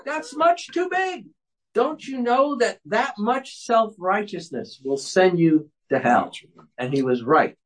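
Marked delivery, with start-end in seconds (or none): 0.1-1.3 very high, stretched-out exclamation; preacher's mock-indignant retort. Professor answering the woman's complaint, high theatrical retort. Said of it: "And Gerstner replies". 1.7-6.3 slow, higher-pitched admonition settling down at the end; imitated preacher's rebuke. Professor warning the woman about self-righteousness, slow imitated rebuke. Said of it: "And Gerstner replies".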